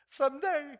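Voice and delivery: high, plaintive mock sob of a new mother; tearful wife. Pastor imitates his crying wife rocking their one-day-old. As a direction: voice his weepy wife lamenting. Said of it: "She said".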